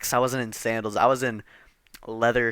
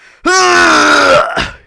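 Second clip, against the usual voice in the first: loud high strained groan under the weight; 13 dB louder than usual. Real, not acted. Straining to lift the door, loud groan. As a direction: heave out a loud effortful groan.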